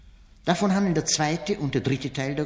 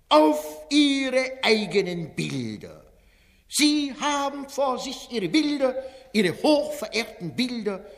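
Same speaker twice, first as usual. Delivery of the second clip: Wenzel Wondrak, fairground barker; 3 semitones higher than usual. Acted. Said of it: "steht der Ausrufer Wenzel Wondrak".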